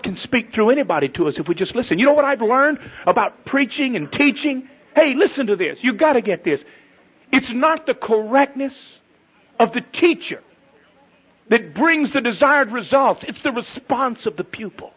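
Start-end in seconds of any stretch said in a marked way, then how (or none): 2.0-13.2 high, emphatic pulpit delivery; forceful preaching. Real, not acted. Preacher urging listeners to get this, forceful. 13.3-14.9 high-pitched, insistent pulpit point; emphatic preaching. Real, not acted. Preacher correcting ideas about teaching, emphatic. Preaching punchline, first take.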